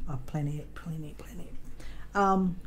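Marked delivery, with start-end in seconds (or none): 0.1-1.5 breathy whisper to herself; soft whispered muttering to herself; whispered aside. Real, not acted. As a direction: hush it under your breath. Whispered aside while counting.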